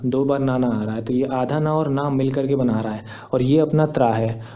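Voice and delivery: usual speaking voice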